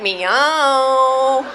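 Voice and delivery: put-on loud, long-held Mexican-accented meow; cat with Mexican accent. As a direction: belt out a cat's meow in a mock Mexican accent. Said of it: "They have a little bit of an accent".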